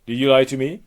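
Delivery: loud imperious challenge; accusing goddess. Acted. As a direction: confront a suspected liar loudly. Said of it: "She goes".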